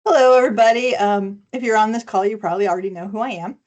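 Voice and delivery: ordinary talk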